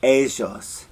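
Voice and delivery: "ellos" with the LL as a ZH sound, "ezhos"; Argentine pronunciation. Shown as a demonstration. Teacher showing the Argentine sound. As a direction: buzz the LL in "ellos" like "eh-zhos". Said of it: "in Argentina, they say".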